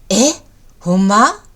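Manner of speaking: surprised question in a Kansai accent, last word rising; Kansai dialect. Shown as a demonstration. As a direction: ask like a Kansai local. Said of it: "関西人っぽくなりますね". (関西弁で驚いて聞き返す)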